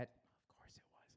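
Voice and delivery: conspiratorial whisper; whispered aside. Real, not acted. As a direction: whisper it to the crowd.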